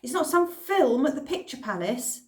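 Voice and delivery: through gritted teeth. Acted. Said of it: "I said through gritted teeth".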